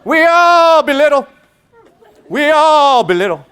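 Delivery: loud, high, drawn-out sermon cry; rally the crowd like a fervent boy preacher; Martin Luther King Junior. Retold or imitated. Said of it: "Howard spoke like Martin Luther King Junior".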